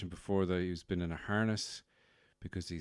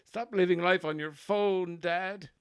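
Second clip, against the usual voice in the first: high-pitched and louder put-on Offaly farmer scolding Dad; baby son's Offaly farmer voice; 11 semitones higher, 6 dB louder than usual. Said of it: "that's his voice".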